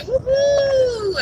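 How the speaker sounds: high joyful whoop; cheering whoop. Real, not acted. Vlogger cheering a new vanner joining the family, high whoop. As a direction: let out a happy woohoo.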